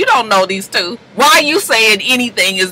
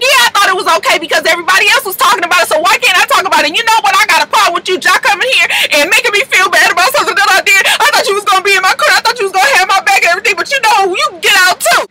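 mock indignant rant; 8 semitones higher, faster than usual. Treasure lashing out at Jock, fast high rant. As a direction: rattle off the grievances. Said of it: "she was just like".

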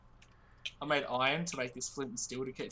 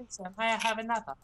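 high, quick, pleading British voice; British accent; 7 semitones higher, faster than usual. Acted. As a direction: play a British urchin asking for more. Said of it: "when you do your British accent".

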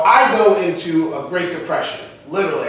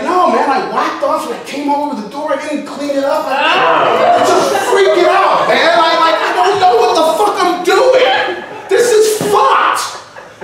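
hammed-up frantic wail; acted freak-out; 8 semitones higher than usual. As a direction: act out a friend losing it, pitch climbing to a yell. Friend retold as freaking out, frantic.

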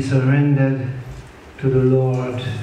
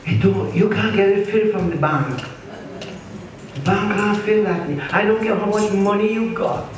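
rising preaching fervor; 7 semitones higher than usual. Acted. Preacher rising on bank point.

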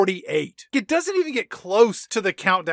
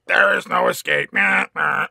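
demon voice; 5 dB louder than usual. Retold or imitated. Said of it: "the demon monster says, like".

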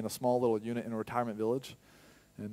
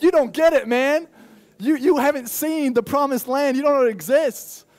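excited protest; 13 semitones higher, 12 dB louder than usual. Retold or imitated. Pastor answering Brisbane people about Gimpy, loud excited protest. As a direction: burst out with pride. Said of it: "I'm like".

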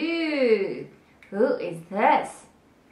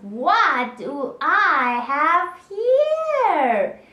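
slow, higher and louder snake voice; gloating snake; 5 semitones higher, 5 dB louder, much slower than usual. Acted. Snake spotting a warm lizard in the sun, gloating. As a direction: draw it out, delighted at the find.

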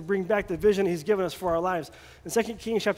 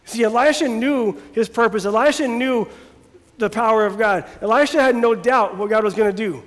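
louder preaching with rising fervour; full-force preaching; 6 dB louder than usual. Acted. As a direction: preach it out to the congregation.

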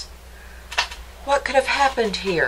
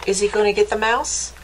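plain reading voice

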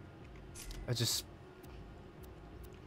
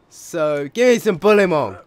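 much louder call for Bullymong to show up; loud demand; 5 semitones higher, 11 dB louder than usual. Real, not acted. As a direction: shout at the game to give me some Bullymong. Demanding enemies to spawn.

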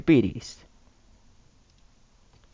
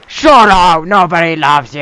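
mock angry dad; 7 dB louder than usual. Dad yells at son.

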